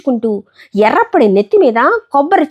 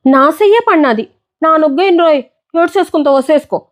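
taunt; 6 semitones higher than usual. Acted. Bully taunting the other boy.

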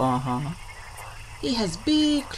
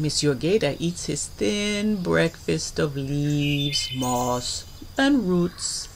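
plain reading voice

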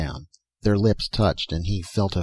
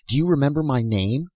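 higher, urgent question; crying out; 6 semitones higher than usual. A narrator's reading. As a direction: press eagerly for an answer. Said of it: "he cried".

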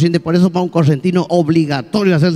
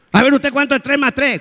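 drill sergeant; 7 semitones higher than usual. Acted. Sergeant quizzing a soldier.

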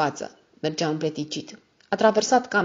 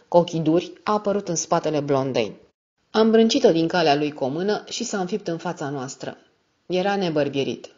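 plain reading voice

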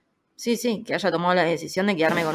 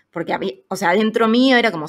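ordinary talk, nothing marked

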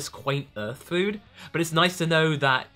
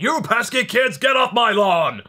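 put-on grumpy old man; 6 semitones higher, 7 dB louder than usual. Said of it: "Beerus in the background saying".

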